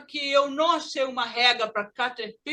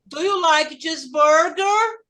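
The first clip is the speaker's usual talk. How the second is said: "Do you like cheeseburger?" slow, higher, rising at the end; American question intonation; 4 semitones higher, 5 dB louder than usual. Shown as a demonstration. Teacher shows pupils the American accent, rising question tune. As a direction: stretch it and lift "cheeseburger" upward. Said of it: "Esse é o sotaque americano".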